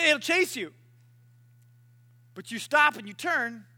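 ordinary delivery

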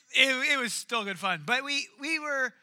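usual speaking voice

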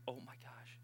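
hushed, voiceless mock astonishment; breathy whisper of disbelief; whispered shock. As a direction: whisper it like a stunned mother. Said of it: "she goes".

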